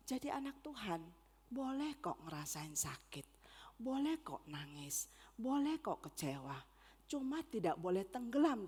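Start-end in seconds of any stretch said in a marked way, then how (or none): 1.5-6.6 soft, low, tender permission; gentle reassurance. Real, not acted. Preacher telling God's children it is okay to cry, gently reassuring. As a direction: reassure them softly, almost hushed. Reassures listeners that hurting is allowed.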